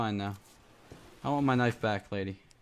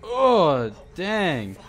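startled yelp; 5 semitones higher, 7 dB louder than usual. Real, not acted. Jump scare in horror game.

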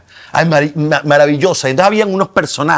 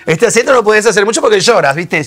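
higher, louder mock Argentine lament; mock Argentine accent; 7 semitones higher, 3 dB louder than usual. Joking that the Argentine accent makes you cry, mock Argentine lament. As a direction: play up the Argentine melancholy. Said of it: "Este acento te trae depresión".

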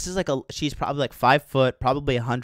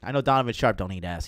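usual speaking voice

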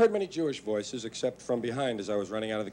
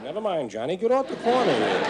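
put-on higher, louder Irish-accented scolding; New York Irish mother; 5 semitones higher, 5 dB louder than usual. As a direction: shoo the kid off the corner. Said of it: "Even your mother".

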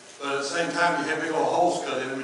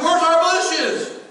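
much higher and louder, slow and drawn out; dismayed yell; 13 semitones higher, 10 dB louder, much slower than usual. Retold or imitated. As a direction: demand where the bushes went. Pastor demanding the bushes.

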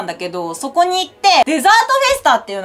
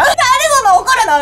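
high, fast, incredulous retort; baffled protest; 10 semitones higher, 3 dB louder, faster than usual. Retold or imitated. Recalling her reaction to the snapping clerk, high fast baffled protest. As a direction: toss it off high and quick, stunned.

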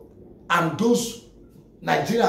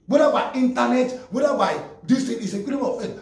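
louder, higher insistence; emphatic; 6 semitones higher, 3 dB louder than usual. Real, not acted. Vlogger insisting gangs are illegal online too, emphatic. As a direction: lay down the law firmly.

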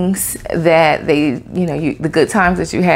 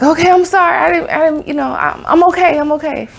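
higher, nervous rushed apology; flustered apology; 8 semitones higher than usual. Retold or imitated. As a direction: fumble the apology, pitched way up. Flustered apology after outburst.

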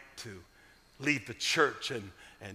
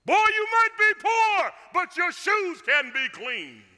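booming, much higher and louder; drill sergeant shout; 14 semitones higher, 9 dB louder than usual. Retold or imitated. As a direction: bark it like a Marine drill instructor. Marine pastor barking at boy.

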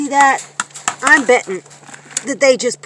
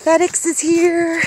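high, drawn-out singsong news; excited announcement; 8 semitones higher, 3 dB louder, slower than usual. Real, not acted. Vlogger telling viewers FedEx has come, real excitement. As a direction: announce the delivery high and slow, thrilled. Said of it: "I'm so excited".

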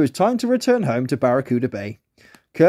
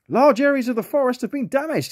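high otter voice with bad news; an otter breaking grim news; worried otter; 10 semitones higher than usual. A narrator's reading. Otter warning his friends about the forest, high and worried. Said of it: "he said".

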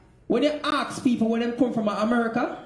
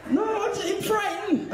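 frightened; 8 semitones higher, 3 dB quieter than usual. Acted. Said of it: "that Jamaican saying".

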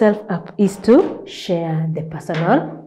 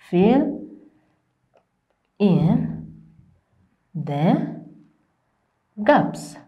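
one word at a time, long pauses; much slower than usual. Shown as a demonstration. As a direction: dictate slowly, pausing after each word. Teacher dictates word by word.

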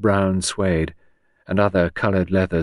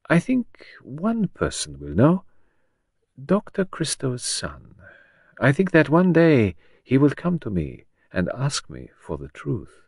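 lightly voiced Belgian detective, measured; a hint of a French accent, unhurried; Poirot's French accent; 6 semitones higher than usual. Poirot predicts the son's return.

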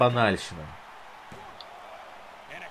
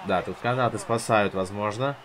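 ordinary talk, nothing marked

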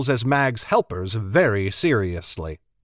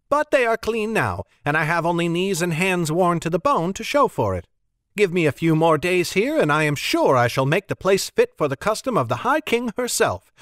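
prim Annis; 3 semitones higher than usual. Acted. Annis complaining to the table, prim and fussy. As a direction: complain grandly of your labours.

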